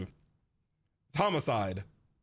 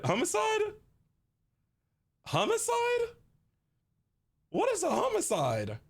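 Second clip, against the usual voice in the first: ordinary delivery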